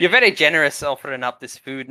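lightly Scottish grateful local; a hint of Scottish thanks; Scottish accent. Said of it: "Now I'm Scottish".

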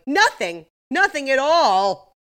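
high, loud mock outburst; acting out indignant people, drawing out the last words; emphatic denial. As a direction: belt it out as an exaggerated denial. Imagined crowd loudly denying it.

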